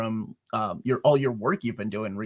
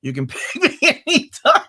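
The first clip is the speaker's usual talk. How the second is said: higher and louder, laughing through a cheesy line; 5 dB louder than usual. Real, not acted. Host teases his guest with a pickup line, laughing.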